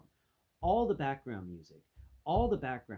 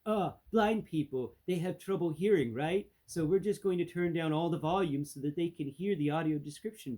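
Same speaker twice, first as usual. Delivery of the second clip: loud, higher mock know-it-all voice; mock streaming executives; 5 semitones higher, 6 dB louder than usual. Vlogger mocking the streaming service's reasoning, loud put-on voice. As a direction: mimic clueless decision-makers, loud and high. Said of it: "They're like".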